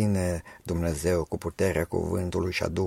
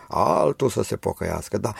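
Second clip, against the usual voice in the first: normal talking voice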